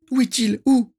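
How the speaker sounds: very high, desperate plea; panicked, high-pitched questioning; terrified. A narrator's reading. Ginny dreads losing her beloved, high and terrified. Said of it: "Sa voix trahissait la terreur ultime".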